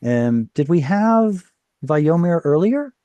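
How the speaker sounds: normal talking voice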